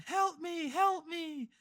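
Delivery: high-pitched drowning woman's cry; ghostly lady. Retold or imitated. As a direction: imitate a lady calling for help in a high voice. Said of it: "we hear a lady behind us".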